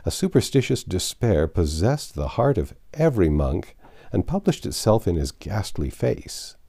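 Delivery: ordinary delivery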